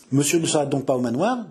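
ordinary talk

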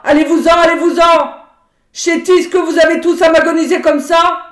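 enraged peasant mother shouting; furious shout. A narrator's reading. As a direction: scream at the intruders to get out. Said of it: "elle cria".

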